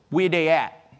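lightly mimicked sassy kid; a hint of a little girl challenging an adult; little girl's dialect. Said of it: "This is the way she talked".

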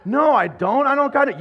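loud, high panicked denial; a lost newcomer insisting he can't do it; mock-exasperated I don't got it; flustered protest.

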